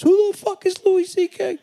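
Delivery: very high, loud impression of dad yelling; imitated parental outburst, pitch through the roof. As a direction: shriek it like an outraged dad. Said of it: "I was completely yelled at".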